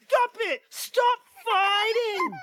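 put-on high, drawn-out childish wail; hammed-up tearful little bear; wailing Baby Bear. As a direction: wail for your parents to stop. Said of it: "And Baby Bear's like".

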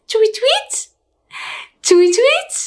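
high chirpy bird call; bird tweet. Pretend. Bird sound in song.

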